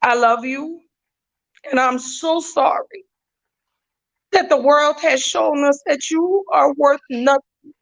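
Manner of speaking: fighting back tears; heartfelt tribute close to tears; emotional. Real, not acted. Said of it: "I'm not gonna get emotional".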